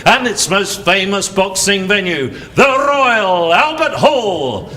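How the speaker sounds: announcer welcoming the crowd to a title fight, booming; boxing ring announcer. Acted. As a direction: call the fighters into the ring at the Royal Albert Hall. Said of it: "you're a prize fight announcer".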